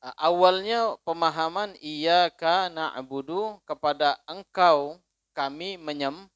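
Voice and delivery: ordinary delivery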